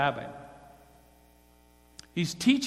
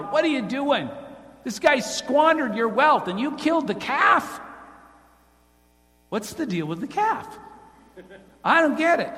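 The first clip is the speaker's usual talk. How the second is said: loud, high outraged brother; shouted protest, pitch up; indignant shout; 8 semitones higher, 5 dB louder than usual. Acted. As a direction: hurl it at the father in disbelief. Brother confronts father.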